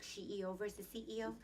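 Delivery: usual speaking voice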